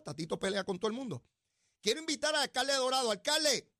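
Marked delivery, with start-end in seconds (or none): 1.8-3.7 calling out. Real, not acted. Calling out to absent mayor.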